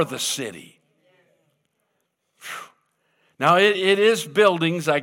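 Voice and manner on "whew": ordinary talk, nothing marked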